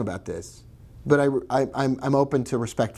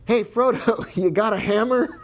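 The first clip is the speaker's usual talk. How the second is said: high, laughing silly quote; playful high voice with a laugh; mock Gandalf; 11 semitones higher than usual. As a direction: toss it off as a goofy wizard.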